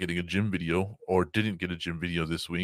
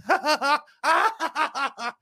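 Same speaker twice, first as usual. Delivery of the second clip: high relieved laugh and curse; 14 semitones higher, 5 dB louder than usual. Retold or imitated. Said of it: "I'm just like".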